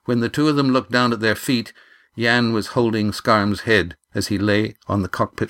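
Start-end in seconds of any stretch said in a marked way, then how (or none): none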